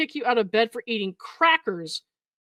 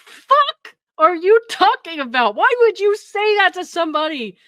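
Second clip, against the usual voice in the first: higher, louder, slowed-down disbelief; exasperated rant; 6 semitones higher, 5 dB louder than usual. Real, not acted. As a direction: scold him like he must stop now. Exasperated outburst at creepy message.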